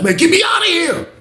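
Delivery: high shouted plea to escape; imagined yell. Retold or imitated. Inmate wants to shout at the CO to get out. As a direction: yell it high, like he's desperate to leave. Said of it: "He looked like he wanted to yell that".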